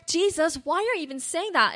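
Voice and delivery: dismayed disciple. Acted. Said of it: "Can you imagine how the disciples feel?".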